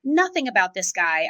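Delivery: usual speaking voice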